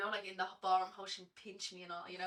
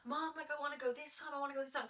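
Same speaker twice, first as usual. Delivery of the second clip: higher-pitched eager begging; pleading child; 7 semitones higher than usual. Retold or imitated. Dancer voicing younger self begging mum for class, higher-pitched pleading. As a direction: imitate a kid pestering for permission.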